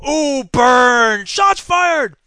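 loud, high crowing over a burn; mock hype. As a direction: whoop it up like a mock hype man. Hyping own put-down.

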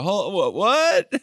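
high, stunned huh-what with a laugh breaking in; squeaky mock shock, cracking into a laugh; mock bafflement. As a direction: play the deflated brother, caught off guard. Brother deflated by snub.

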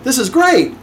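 high, upbeat mock delight; delighted reaction. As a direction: act thrilled to see who's calling. Happy reaction to incoming call.

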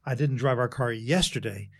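"yesterday" set apart and emphasized at the end; stress on YESTERDAY. Shown as a demonstration. Coach showing how emphasis changes meaning, stress on "yesterday". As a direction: save the weight for "yesterday". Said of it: "change the emphasis on any one".

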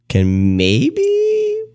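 high, drawn-out coy hinting. Real, not acted. Host coyly inviting listeners to get in touch, coy. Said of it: "You're so coy".

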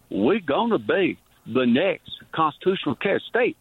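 put-on Louisiana accent. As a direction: mimic his Louisiana Southern accent. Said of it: "in his accent".

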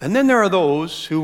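ordinary delivery

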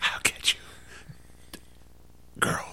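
soft unvoiced whisper. Playing the villain Whisper, whispered softly. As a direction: hiss it like a sneaky villain.

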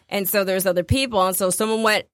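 ordinary delivery

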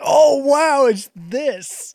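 high, loud "wow" that eases off; thrilled gasp of recognition, acted out; heart-racing excitement. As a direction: retell an awestruck first reaction. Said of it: "my heart, like, started racing".

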